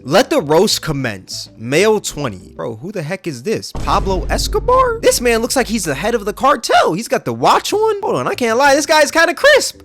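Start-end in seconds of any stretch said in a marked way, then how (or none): 3.7-9.8 excited. Real, not acted. Excited over a photo.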